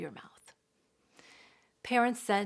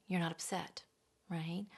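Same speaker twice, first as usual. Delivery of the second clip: quiet denial; 2 semitones lower, 9 dB quieter than usual. Shown as a demonstration. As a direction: say it almost under the breath. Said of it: "So we deny the feeling".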